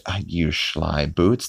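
slow, drawn-out teasing line; slow sly boots. Retold or imitated. Fan quoting Peter's line slowly, teasing and amused. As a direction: savor each word like a sly compliment. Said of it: "that part where he goes".

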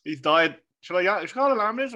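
high, fast, laughing alarm; mock panic. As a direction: fake urgency while cracking up. Laughing at friend choking.

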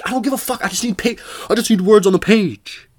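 high, exasperated rant building to a shout; fed-up outburst. Acted. Student answers the fussy peer reviewer, in a fed-up outburst. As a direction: burst out high, loudest on the last words.

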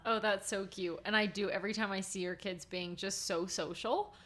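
ordinary talk, nothing marked

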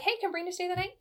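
bright high-pitched daughter asking; voicing daughter's request. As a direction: lift into the daughter's hopeful ask. Daughter's text voiced in story.

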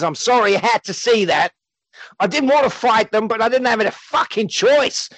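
put-on would-be London accent gone Australian; Gary's Australian-sounding accent. Gary explaining the fight to his daughter, Australian-sounding accent. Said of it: "your accent makes him sound Australian".

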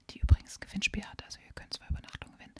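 rushed whispered aside; fast whisper. Real, not acted. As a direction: whisper the plug quickly. Quick whispered plug aside. (schnell geflüstert)

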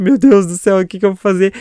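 acting out a frightened man; retold terror. Man alone with fallen mannequin. (desespero encenado, mais alto e agudo)

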